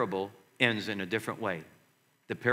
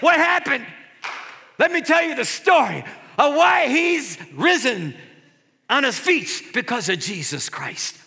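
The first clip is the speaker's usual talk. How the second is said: high, loud astonished exclamation; amazed onlookers; 13 semitones higher, 9 dB louder than usual. Retold or imitated. Crowd seeing the man rise from his wheelchair, amazed. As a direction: gasp it out in wonder.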